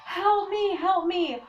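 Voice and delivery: high, loud re-enacted cry for help; screaming for help. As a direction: act out a desperate yell to a passerby. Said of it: "I started screaming at him for help".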